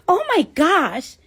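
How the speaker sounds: put-on high, stretched-out gushing girl voice. The OBGYN reacting during the sonogram, imitated as a high gushing girl. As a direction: coo it like a chatty Staten Island girl.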